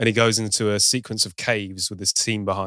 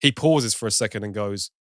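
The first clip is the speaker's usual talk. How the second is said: ordinary talk, nothing marked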